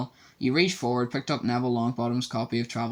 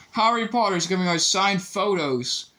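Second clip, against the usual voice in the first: jeering bully; 8 semitones higher, 4 dB louder than usual. A narrator's reading. Malfoy jeering to the crowd.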